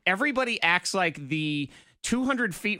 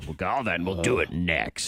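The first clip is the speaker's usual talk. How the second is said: big radio guy voice; 11 semitones lower than usual. Shown as a demonstration. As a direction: boom it out like a radio DJ. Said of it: "Want to sound like a big radio guy".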